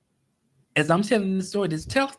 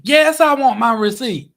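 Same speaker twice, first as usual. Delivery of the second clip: louder, higher, pointed insistence; firm loud reply; 6 semitones higher, 9 dB louder than usual. Retold or imitated. Customer insisting to the cashier he wants his receipt, loud and firm.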